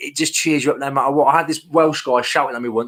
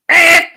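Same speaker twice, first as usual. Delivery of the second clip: loud, high-pitched tape squawk; imitated tape screech; 10 dB louder than usual. Man showing viewers how his tape sounds, imitated screech. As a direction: mimic the screech of noisy packing tape. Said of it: "that's exactly what it is".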